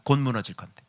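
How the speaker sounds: ordinary delivery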